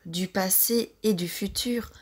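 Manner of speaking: ordinary delivery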